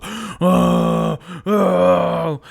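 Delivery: two loud, high, long screams; startled character's drawn-out yell; screaming. Acted. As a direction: wail out in alarm, twice. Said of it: "and he starts screaming".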